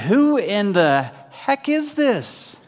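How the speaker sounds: drawn-out disbelief at who he is; astonished disciples. Retold or imitated. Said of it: "what's the question they're asking?".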